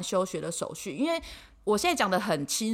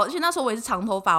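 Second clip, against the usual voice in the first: ordinary talk, nothing marked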